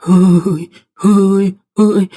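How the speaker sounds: eerie, spaced "huuy" moans; ghostly woman's moan. Retold or imitated. Woman crawling down the stairs, still moaning eerily. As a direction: imitate the ghost woman's lingering cry. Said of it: "ហើយសំឡេងល្ងាហ្នឹងនៅតែឮ".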